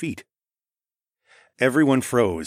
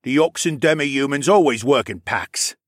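louder, slightly raised character voice; lightly voiced accuser; 5 semitones higher, 5 dB louder than usual. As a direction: explain the accusation with some force. Narrator voices Nawel explaining.

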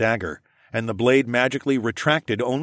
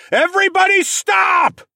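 very high, loud scream; 8 dB louder than usual. A narrator's reading. Stopping two charging war parties, a scream. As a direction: scream to halt everyone at once. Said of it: "I screamed".

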